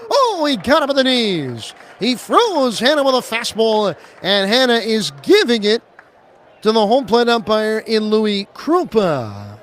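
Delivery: excited strikeout call. Real, not acted. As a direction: call the strikeout with a jolt of energy.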